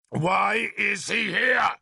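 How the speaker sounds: slow, high, angry question; angry demand. A narrator's reading. Arthur demanding an answer of Merlin, angry demand. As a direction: drag each word out in anger. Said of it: "more angrily".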